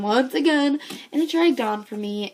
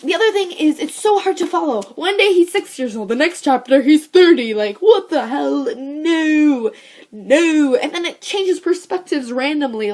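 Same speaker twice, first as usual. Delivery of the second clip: high, loud, indignant complaint; worked up and incredulous; exasperated rant; 5 semitones higher, 6 dB louder than usual. Real, not acted. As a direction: build to a loud, fed-up refusal.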